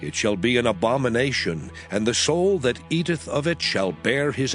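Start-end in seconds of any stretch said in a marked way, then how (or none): none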